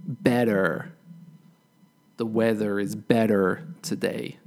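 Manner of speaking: "better" and "weather" with strong final R; American strong R. Shown as a demonstration. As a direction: sound the R at the end of "better", American style. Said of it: "the R is very pronounced at the end".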